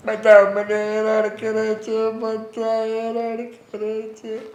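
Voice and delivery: slow, hammed-up whining lament; mock whimper. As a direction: drag it out like a fake sob. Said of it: "રડો છો તમે?".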